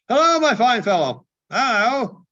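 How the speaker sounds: high, loud joyful call; joyful shout. Acted. Scrooge hailing the boy, loud and joyful. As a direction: hail him across the street.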